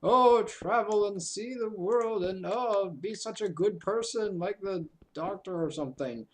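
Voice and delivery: gently mocking idealist voice; lofty idealist. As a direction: play the earnest world-traveller type. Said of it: "I'm always one of these".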